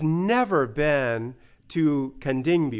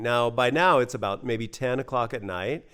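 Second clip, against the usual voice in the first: ordinary delivery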